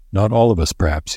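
ordinary delivery

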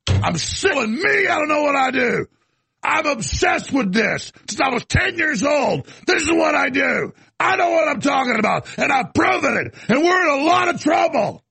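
high, shouted tirade; real angry rant. Real, not acted. Ranting on air to listeners, real angry shouting. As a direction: rage about being ignored. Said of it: "See, I'm getting mad".

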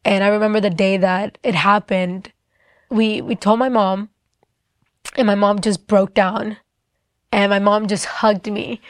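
usual speaking voice

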